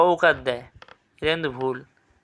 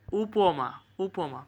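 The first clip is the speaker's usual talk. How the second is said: ordinary delivery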